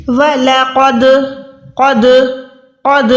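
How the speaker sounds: क़द with the final d released in an echoing bounce; qalqalah. Shown as a demonstration. Teacher contrasting the right way, qalqalah echo on क़द. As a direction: make the द of क़द vibrate back after closing. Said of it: "अगर मैं ऐसे पढ़ूंगी".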